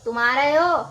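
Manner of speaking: loud angry demand. Shown as a demonstration. Teacher repeats the angry example, loud and angry. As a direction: fling it out in anger. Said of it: "अब मैंने गुस्से में बोला".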